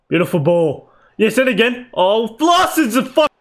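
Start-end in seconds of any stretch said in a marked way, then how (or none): none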